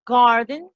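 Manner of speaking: "garden" with a firm, punched G; "garden" as an English model word, G stressed; emphasized English G. Shown as a demonstration. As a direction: lean on the first sound of "garden". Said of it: "Un poquito más enfatizado".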